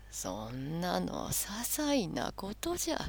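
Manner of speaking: put-on slow, soft old man; wise old man. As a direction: brush it off gently. Santa's calm reply.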